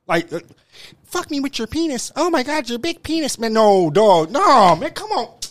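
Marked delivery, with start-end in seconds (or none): 1.0-3.5 high breathy mock seductive woman; mock moaning woman. Imitating a woman in bed. 3.5-5.4 mock exasperated refusal. Refusing her loudly.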